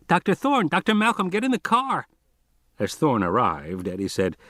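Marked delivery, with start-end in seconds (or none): 0.1-2.1 put-on much higher, scratchy boy's voice calling over the radio; a kid on the radio, urgent and high; insistent high scratchy boy on the line. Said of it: "said a scratchy voice".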